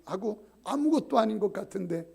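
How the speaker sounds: ordinary delivery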